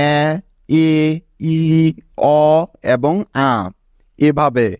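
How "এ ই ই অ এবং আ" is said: each vowel sounded alone, এ, ই, ই, অ, আ, with pauses between; open-syllable vowel sounds. Shown as a demonstration. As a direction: sound out এ, ই, ই, অ, আ one at a time. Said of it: "open সিলেবলে এই পাঁচটি ভাওয়েলের উচ্চারণ হবে".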